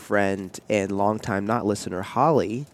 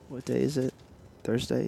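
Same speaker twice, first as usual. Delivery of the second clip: usual speaking voice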